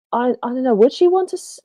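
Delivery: ordinary talk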